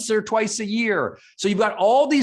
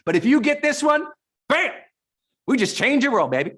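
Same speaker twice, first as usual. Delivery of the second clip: fired up; 7 semitones higher than usual. Real, not acted. Host fired up by green tea, hyping his webinar audience. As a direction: pump up the room with real excitement. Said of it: "I'm getting fired up now".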